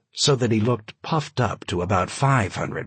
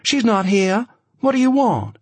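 sharp higher voice on the attack; testy; 12 semitones higher, 4 dB louder than usual. A narrator's reading. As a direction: push back, testy and defensive. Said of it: "She was testy, already on the attack".

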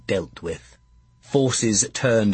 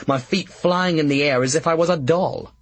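ordinary delivery

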